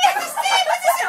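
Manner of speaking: very high, loud falsetto shout; mock frantic shriek. Retelling the argument over the money, mock frantic high shout. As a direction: squeal it in hammed-up agitation.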